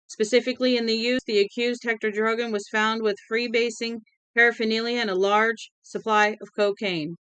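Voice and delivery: rapid auctioneer patter; fast like an auctioneer. Acted. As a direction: rattle the charges off fast. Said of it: "He spoke fast, like an auctioneer".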